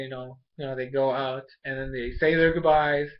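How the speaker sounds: ordinary talk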